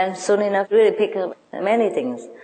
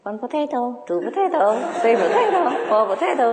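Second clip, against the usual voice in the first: high, chirpy parrot chant of potatoes; a parrot's sing-song counting rhyme; squawky parrot; 5 semitones higher than usual. Retold or imitated. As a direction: play the parrot rattling off a list. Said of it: "he heard a voice say".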